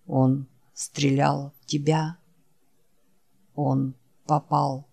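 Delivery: low, soft, worn-out creature voice; barely has the strength to say the name; weary dying Wolf. Acted. Wounded Wolf calling his friend Jack, low and faint. Wolf's voice.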